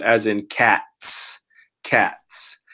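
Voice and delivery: cats said slowly, stressing the ts; ts sound. Shown as a demonstration. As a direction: end cats on a crisp ts. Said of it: "It makes a T sound".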